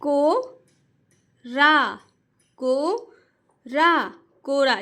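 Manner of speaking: "को" and "रा" split apart, then "कोरा" joined slowly; syllable-by-syllable reading. Shown as a demonstration. Teacher shows parents how children should read, split then blended. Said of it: "जिस तरीके से मैं पढ़ रही हूँ".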